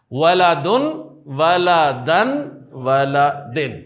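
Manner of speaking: ولدن، ولدن، ولدن said slowly, the final vowel changing un, an, in; three case endings. Shown as a demonstration. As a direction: spell out the endings of ولدن one by one. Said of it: "یہ واحد مذکر اسم میں نے بولے ہیں". (ولدن کی تینوں حالتیں الگ الگ ادا کرنا)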